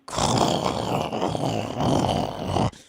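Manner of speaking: breathy unvoiced giggle; embarrassed laugh. Real, not acted. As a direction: laugh it off nervously. Laughs during spicy passage.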